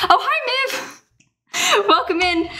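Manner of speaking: high, slow delighted hello; excited greeting. Real, not acted. Greeting a viewer.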